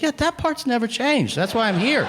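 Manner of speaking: hammed-up exasperated customer. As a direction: plead with the clerk in disbelief. Exasperated at the clerk.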